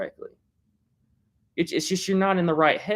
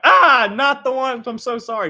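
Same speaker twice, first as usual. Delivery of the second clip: startled apology; 9 semitones higher, 7 dB louder than usual. Retold or imitated. Father realizing he grabbed the wrong little girl, startled. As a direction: blurt it out in shock.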